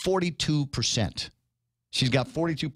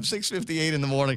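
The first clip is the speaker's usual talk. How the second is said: normal talking voice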